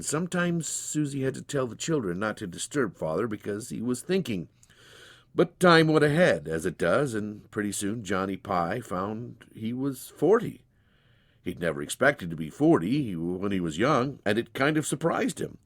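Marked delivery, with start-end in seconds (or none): none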